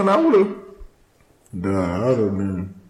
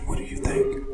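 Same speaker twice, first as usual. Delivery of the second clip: hushed unvoiced whisper; 8 dB quieter than usual. Real, not acted. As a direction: breathe it without voice. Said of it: "Why are we whispering?".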